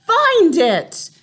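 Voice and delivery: high, loud scream; Grand High Witch's screamed command. A narrator's reading. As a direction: scream the order. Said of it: "screamed the Grand High Witch".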